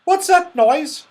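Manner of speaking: high, sudden startled question; sudden alertness. A narrator's reading. Goldilocks hears a noise in the cottage, suddenly alert. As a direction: break in with a high, loud query. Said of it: "said Goldilocks suddenly".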